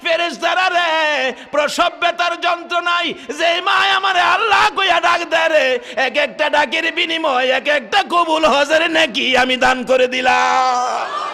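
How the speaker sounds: high, loud preacher's chant of a divine decree; proclamation. Acted. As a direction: proclaim to the angels at full force, drawing out the endings. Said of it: "আমার আল্লাহ ঘোষণা করে দেয়".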